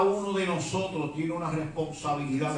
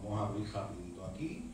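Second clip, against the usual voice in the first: quiet, much softer prayerful murmur; 4 semitones lower, 14 dB quieter than usual. Real, not acted. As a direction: pray quietly to Jesus.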